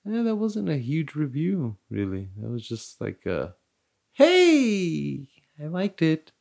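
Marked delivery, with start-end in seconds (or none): 4.1-6.3 high, drawn-out creepy greeting; giggly creep. Acted. Host mimicking himself at an old review, creepy and high. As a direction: put on a high, boyish creep voice. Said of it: "he said he liked it, and then he giggled".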